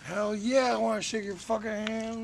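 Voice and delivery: ordinary talk, nothing marked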